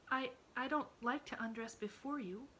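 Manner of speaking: put-on a shy child stammering her refusal; faltering girl. A narrator's reading. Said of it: "faltered Emily".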